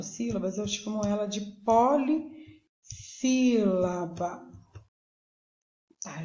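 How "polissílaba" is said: "polissílaba" slow, with a long break after "poli"; syllable by syllable. Shown as a demonstration. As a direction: draw out "polissílaba" in separate parts. Teacher splitting the term into syllables.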